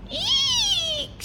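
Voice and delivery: tiny squeal shot way up high; a startled witch squeaks in fright; frightened shriek. Acted. Witch hears George is hunting a dragon, shrieks high in fear. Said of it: "shrieked the witch".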